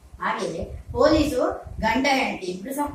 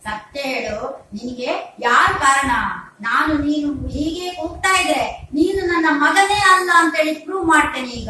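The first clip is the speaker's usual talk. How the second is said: mock courtroom challenge building louder and higher; prosecuting lawyer; 4 semitones higher, 5 dB louder than usual. Lawyer father threatens to disown crying baby, rising mock argument. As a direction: argue your case, rising to a threat. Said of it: "ಆ lawyer ಹೇಳ್ತಾನೆ".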